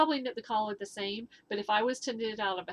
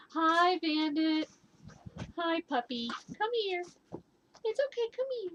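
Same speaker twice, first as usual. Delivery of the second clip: high sing-song puppy talk, reassuring the dog during thunder; 9 semitones higher than usual. Real, not acted. Cooing to frightened dog.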